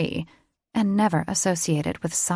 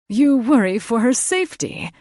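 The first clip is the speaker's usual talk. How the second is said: higher, louder, taunting lilt; mocking; 5 semitones higher, 6 dB louder than usual. A narrator's reading. Father mocking the mates' worry, higher and louder. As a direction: needle them with mock concern. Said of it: "His tone was mocking".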